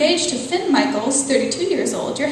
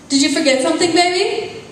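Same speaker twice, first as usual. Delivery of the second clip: higher, louder sing-song call to her fiancé; woman calling to her fiancé she thinks is home, higher and louder; Autumn calling sweetly; 7 semitones higher, 4 dB louder, faster than usual. Acted. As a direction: call out affectionately from upstairs. Autumn calls out to Finn.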